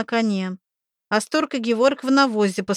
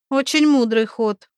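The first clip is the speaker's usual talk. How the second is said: ordinary delivery, nothing marked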